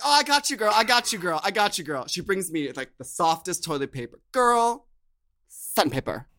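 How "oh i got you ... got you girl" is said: friend's eager reply. Retold or imitated. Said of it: "She's like".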